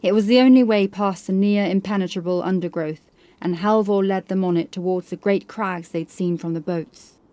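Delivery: ordinary delivery, nothing marked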